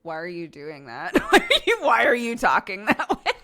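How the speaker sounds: voiced question breaking up into laughter; laughing quote. Quoting a puzzled question about someone's talking, laughing.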